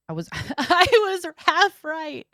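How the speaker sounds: laughing through it, high. Real, not acted. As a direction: giggle out the words. Happy at half-right answer.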